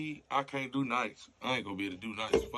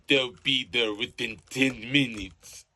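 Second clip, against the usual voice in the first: much louder, slow and deliberate; firm announcement; 11 dB louder, slower than usual. Acted. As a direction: lay down the promise. Dispatcher announcing firmly.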